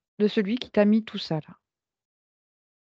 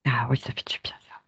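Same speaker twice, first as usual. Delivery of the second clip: whispering; 4 semitones lower than usual. Real, not acted. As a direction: whisper back gently. Soigneur répond en chuchotant. (chuchotement doux)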